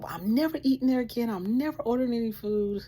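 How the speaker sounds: pouty protest to husband; indignant vow. Retold or imitated. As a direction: swear off the place. Vowing never to return.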